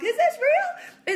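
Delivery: very high, loud, stretched question; hammed-up incredulous cry; mock disbelief. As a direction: squeal it in exaggerated bewilderment. Retold disbelief at her own day.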